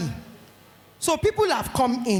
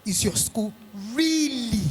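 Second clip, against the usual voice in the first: slow emphatic question; much slower than usual. Shown as a demonstration. Drawn-out climax of the question.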